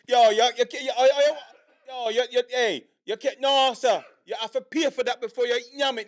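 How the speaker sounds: high, agitated mock Jamaican scolding; hammed-up Jamaican cashier telling off a thief; Jamaican accent impression. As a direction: stammer out objections in a put-on Jamaican accent. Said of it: "it's like Italian versus Jamaican".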